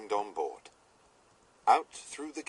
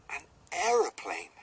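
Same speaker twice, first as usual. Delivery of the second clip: higher and louder, thrilled discovery; excited cry; 8 semitones higher, 7 dB louder than usual. Acted. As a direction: shout it out with delight. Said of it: "cried Billy".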